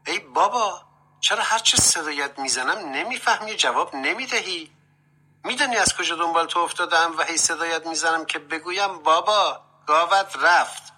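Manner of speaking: high-pitched, exasperated call; loud calling out. A narrator's reading. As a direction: call out insistently to someone who isn't answering. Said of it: "با صدای بلند گفت". (با صدای بلند و معترض)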